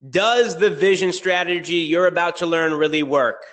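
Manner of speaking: hyped-up webinar host; upbeat webinar pitch. Acted. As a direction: sell it like a launch announcement. Webinar pitch, raised energy.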